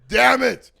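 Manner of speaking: high, loud comic curse; mock exasperated shout. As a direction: blurt it out in fake frustration. Mock frustration outburst.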